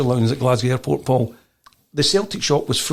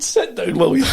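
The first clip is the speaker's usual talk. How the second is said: laughing; 5 semitones higher, 3 dB louder than usual. Real, not acted. Laughing at the retold moment.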